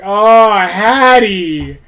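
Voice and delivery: loud, drawn-out cry of disbelief; exasperated exclaim. Real, not acted. Exasperated at the pet Hattie, loud outcry. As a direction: groan out her name then demand.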